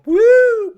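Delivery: high loud whoop of people skidding; mock woo. Friend mimics skidding drivers with a loud woo. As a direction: yelp like a car spinning out. Subarus spinning out in snow.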